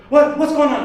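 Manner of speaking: the wife suddenly scared; panicked wife. Retold or imitated. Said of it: "she's like".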